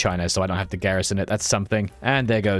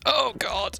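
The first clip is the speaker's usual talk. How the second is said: high dismayed groan; 13 semitones higher than usual. Real, not acted. Dismay at Turkey.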